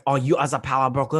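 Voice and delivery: clumsy imitation of a French accent; mock French accent; bad French accent. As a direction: play the Frenchman with a poor accent. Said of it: "I'm not good with the French accent".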